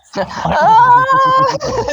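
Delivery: loud high-pitched burst of laughter. Real, not acted. Laughing at friend's joke.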